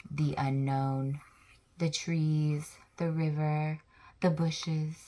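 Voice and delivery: slow, soft and spooky; ominous. Acted. Teacher making the unknown sound spooky for children. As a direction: make the unknown sound scary.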